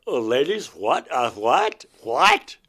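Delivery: stunned, stammering disbelief; shocked disbelief. Retold or imitated. To the ladies after falling back, in rising shocked disbelief. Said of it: "I'm like".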